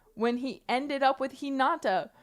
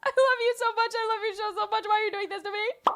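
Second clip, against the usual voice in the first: much higher, whiny hammed-up heartbreak; mock anguished pleading; 13 semitones higher, 3 dB louder than usual. Heartbroken fan hamming up a plea to the writer, whiny and high. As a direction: wail at him like a betrayed fan.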